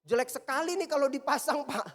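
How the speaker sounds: high, loud imitated complaint; retold protest. Retelling the workshop man's objection.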